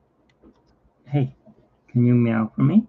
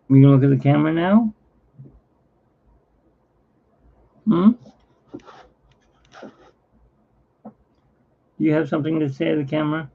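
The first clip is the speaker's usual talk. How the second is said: coaxing the cat; 6 semitones higher, faster than usual. Real, not acted. Coaxing cat toward camera.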